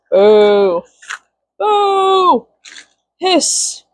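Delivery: hammed-up boo and hiss; mock boo. Booing her husband for taking makeup, mock.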